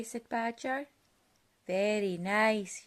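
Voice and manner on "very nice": slow, loud call; yelled. Acted. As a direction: shout it so deaf Badger hears. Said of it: "yelled Rabbit".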